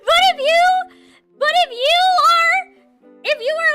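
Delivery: high, loud playful alarm; mock warning shout. Mock-warning chat about adult vtuber.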